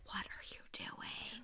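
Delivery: slow, whispered mock disbelief; hushed disbelief. Viewer retells watching the suspect, hushed disbelief. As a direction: whisper it slowly as a retold reaction. Reacting to suspect's searches, exasperated.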